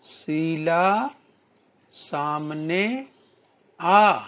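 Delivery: very slow, stretching each word; slow demo reading. Shown as a demonstration. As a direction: read it slowly so the children can copy. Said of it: "आगे बोलिए". (शब्द खींचकर धीरे पढ़ना)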